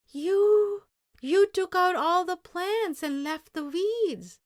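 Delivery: loud, high outraged accusation; shocked anger. Acted. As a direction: blurt it out in angry disbelief. Ben discovers the weeds left.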